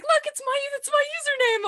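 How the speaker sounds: very high excited squeal of words. Retold or imitated. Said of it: "so s-, excited".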